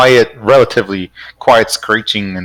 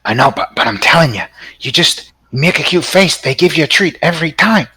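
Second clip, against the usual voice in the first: a bit higher, a hint of a cheeky monkey; lightly voiced monkey; 6 semitones higher, faster than usual. Game master voicing the second monkey, slightly higher and sly. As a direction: nudge the pitch up like a sly monkey.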